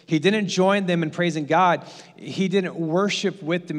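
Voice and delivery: ordinary talk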